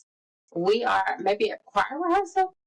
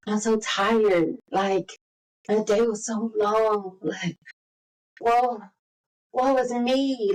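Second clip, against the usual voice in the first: pitiful whining; slower than usual. Retold or imitated. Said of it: "you were so pitiful".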